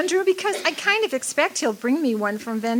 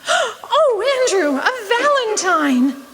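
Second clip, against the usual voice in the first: gasp, then a higher, louder cry of joy; delighted gasp; 8 semitones higher, 3 dB louder, slower than usual. Acted. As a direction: catch your breath at the surprise. Annie discovers the valentine.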